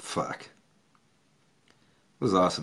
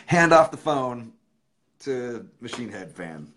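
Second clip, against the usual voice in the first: ordinary talk, nothing marked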